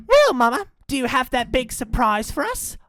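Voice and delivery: put-on high child's voice; eager little joey. Eager joey asks mama.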